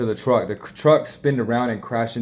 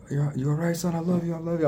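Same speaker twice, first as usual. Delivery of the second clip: pitched up, a touch of a shaken father comforting his son; mild retold distress; 8 semitones higher than usual. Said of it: "I'm, like, all sad".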